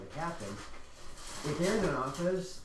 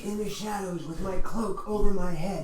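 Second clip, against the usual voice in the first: edgy edgelord voice; 5 semitones higher, 6 dB louder than usual. Pretend. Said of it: "the edgiest edgelord ever".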